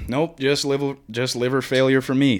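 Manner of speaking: mock polite decline of liver failure; breezy mock refusal. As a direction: turn it down brightly like politely declining a snack. Acting the bit's declining line.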